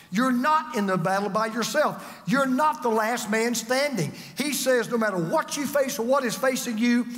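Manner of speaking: usual speaking voice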